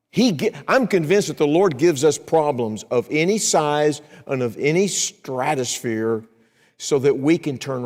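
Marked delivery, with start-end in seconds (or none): none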